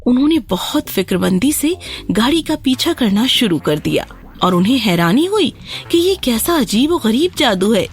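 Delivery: ordinary delivery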